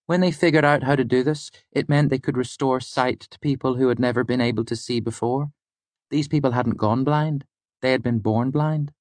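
high-pitched student storyteller; Fela. Acted. Student telling the lecturer a medical discovery, high-pitched. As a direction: explain the fact in a high, even voice. Student tells her fact.

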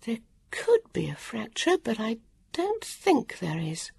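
put-on calm a hurt girl quietly; harassed healer woman. A narrator's reading. Healer reassuring injured Vivian, soft and whispered.

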